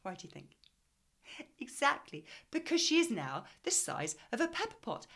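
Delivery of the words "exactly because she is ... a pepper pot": higher, louder emphatic agreement. Real, not acted. Teacher agreeing with the class, emphatic and bright. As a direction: praise the right answer.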